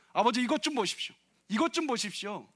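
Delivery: elder son shouting at his father in anger. Retold or imitated. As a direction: yell at the father in protest. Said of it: "이렇게 소리쳤습니다".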